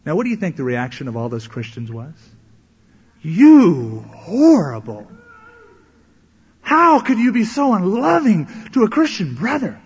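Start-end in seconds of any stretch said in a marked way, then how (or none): 3.2-9.8 loud and high, stretching out horrible; mock outraged shout. Church folk scolding the elder for turning him away, indignant shout. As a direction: cry out in shocked indignation.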